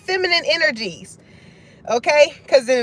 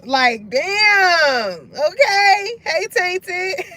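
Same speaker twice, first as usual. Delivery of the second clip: laughing exclamation; 5 semitones higher, much slower than usual. Real, not acted. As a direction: crack up into it. Laughing at the lyric.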